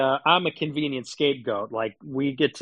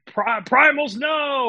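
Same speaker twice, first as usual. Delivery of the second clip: mock Liver King slogan; 11 semitones higher, 3 dB louder, slower than usual. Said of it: "he's like".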